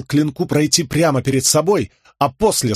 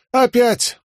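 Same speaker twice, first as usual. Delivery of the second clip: high sharp shout; 11 semitones higher than usual. Acted. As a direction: bark the order. Sparring command.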